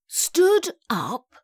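drawn-out, high rising query; slowly. A narrator's reading. Daughter takes in Mum's news, slowly. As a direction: say it slowly, pitch climbing in question. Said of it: "I said slowly".

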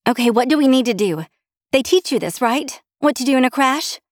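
high-pitched, worried urgency; anxious questioning. A narrator's reading. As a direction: press the pilot for crash instructions. Wife panicking as engines fail.

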